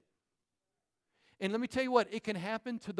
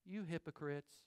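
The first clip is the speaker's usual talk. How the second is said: You hypocrites, much lower and much softer, timid; meek wrong way; 5 semitones lower, 13 dB quieter than usual. Shown as a demonstration. Preacher showing how Jesus did not say it, meek and soft. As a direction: say it meekly, without conviction. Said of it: "he didn't say it like this".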